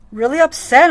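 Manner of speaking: upset outburst; really upset. Real, not acted. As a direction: let the anger burst out.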